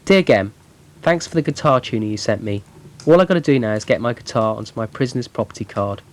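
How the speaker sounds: ordinary talk, nothing marked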